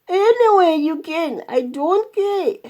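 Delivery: high, stretched-out, mildly groaned protest; groaning elephant. A narrator's reading. Suffering elephant telling the swallowed woman to get out, groaning. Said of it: "groaned the elephant".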